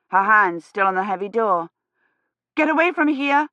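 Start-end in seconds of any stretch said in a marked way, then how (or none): 2.6-3.5 shout. A narrator's reading. Kivrin warning the girl, alarmed shout. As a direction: yell her name in alarm. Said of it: "Kivrin shouted".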